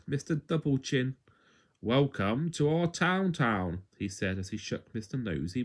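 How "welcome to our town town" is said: slow, lightly voiced mayor; a hint of a pompous mayor; slow welcoming mayor. A narrator's reading. Mayor greets Nosy.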